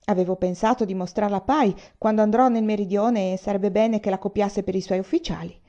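put-on snatch at it like a child; old general demanding the medal back, eager; eager old man. Old man grabbing his medal.

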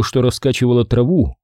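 usual speaking voice

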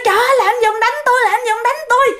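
a wife's panicked high-pitched cry, fast; vợ la hoảng. Retold or imitated. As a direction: shriek it high and rushed as she's being beaten. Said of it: "Cái bà này bà la". (vợ bị chồng đá, la thất thanh, giọng cao và nhanh)

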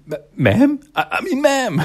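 acted embarrassed backpedal; flustered correction. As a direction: stumble over it, pitch jumping up. Flustered correction after calling her sir.

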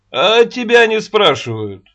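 curt rebuff. Acted. Рогачёв snaps at Аннушка.

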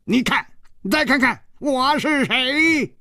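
high character voice, last words drawn out; eccentric old dwarf, showing off. A narrator's reading. Dwarf revealing his bald head, crowing proudly. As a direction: crow it high and slow.

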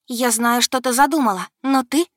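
loud, high, defiant; defiant shout. A narrator's reading. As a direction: stand up to the goddess. Girl shouts defiance at goddess. (дерзкий громкий вызов)

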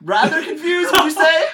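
high, loud incredulous question; hammed-up high-pitched disbelief; mock astonishment. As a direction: shout it back in exaggerated surprise. Mock surprise at guest saying show's name.

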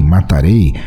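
ordinary delivery, nothing marked

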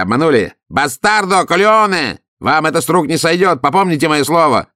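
выше и громче, в ярости; граф бранится, громко и высоко; бешеная брань. A narrator's reading. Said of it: "разразился бешеной бранью". (граф бранится вслед, в ярости, громко)

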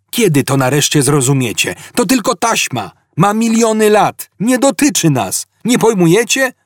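high, insistent outburst; exasperated. A narrator's reading. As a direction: climb high as if nobody will understand. Zaphod exasperated with his crew.

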